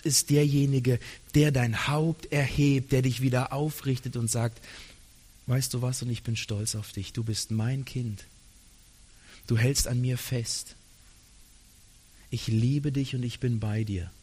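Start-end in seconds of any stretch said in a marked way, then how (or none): 5.5-14.1 low and much softer, reassuring; tender voice of God. Acted. God comforts the fearful listener, soft and low. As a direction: speak it like a comforting father.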